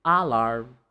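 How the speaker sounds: low AH-larm, full first vowel; stress on first syllable. Shown as a demonstration. Teacher mimics the mistake, first-syllable stress. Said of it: "you don't say alarm".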